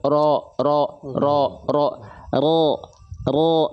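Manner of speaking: low heavy "Ro" with O-like vowel and no pout; thick ro, unpouted. Shown as a demonstration. Teacher has students watch the mouth, thick unpouted ro. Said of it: "Mulut kita nggak monyong".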